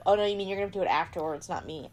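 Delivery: ordinary talk, nothing marked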